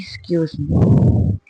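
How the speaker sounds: very slow, breathy whispered Excuse me; drawn-out whispered retort. Acted. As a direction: hiss it out in disbelief. Reaction to drunk partner.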